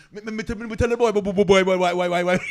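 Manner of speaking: put-on high, fast stuttering Jamaican accent; excited Jamaican storyteller. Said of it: "Especially with the Jamaicans".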